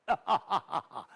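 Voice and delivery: a short scornful laugh with a drop at the end; villain's dismissive chuckle; mocking laugh. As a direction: laugh him off as a fool.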